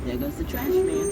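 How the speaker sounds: high sing-song taunt; high mock announcement. Mocking the departing trash man, high sing-song. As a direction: call it out high like a gleeful jingle.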